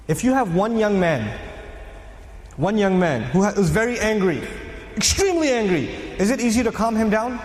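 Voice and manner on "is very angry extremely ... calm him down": ordinary talk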